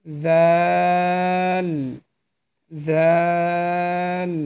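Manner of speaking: "জাল" soft, tongue on tooth tips, very long vowel; touch tongue to upper tooth edges and hold "জাল" out; soft interdental. Shown as a demonstration. Teacher demonstrates the soft letter to learners, "জাল" long and interdental. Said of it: "এই তিনটা হরফ উচ্চারণ করবেন নরমভাবে".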